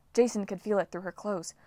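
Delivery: ordinary delivery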